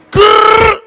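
high, loud rapper growl grrr; demo growl. Shown as a demonstration. Host demoing a rapper ad-lib, loud growl.

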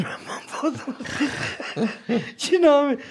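words broken up by laughter; laughing. Real, not acted. Telling his mates about the embrace, laughing. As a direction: laugh it out as you tell it.

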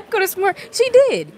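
high, loud laugh running into the words. Real, not acted. Remembering grandma's porch chair with the viewers, laughing.

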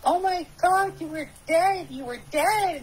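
high, panicked cry of disbelief, imitated; retelling a friend's frantic "you were dead"; shocked friend. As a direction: play her horror, pitched well up. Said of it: "And she said".

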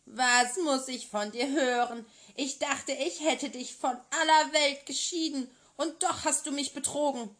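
put-on furious, betrayed old sorceress; angry witch. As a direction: berate the girl who deceived her. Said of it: "rief die Zauberin".

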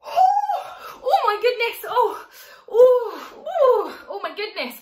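high laughing cries at the chili heat; burning mouth. Real, not acted. To the class, tasting the chili bomb, laughing cries. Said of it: "oh, so hot".

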